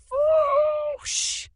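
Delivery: loud, very high swooping whoosh; whoosh sound. A narrator's reading. Narrator imitating the magic blast, whooshing.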